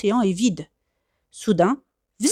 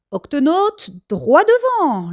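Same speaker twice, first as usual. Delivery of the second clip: higher, slow, louder command; rallying call; 7 semitones higher, 4 dB louder, slower than usual. Acted. Captain sending crew straight ahead, loud slow command. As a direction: send the crew forward. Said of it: "lance le capitaine Barnacle".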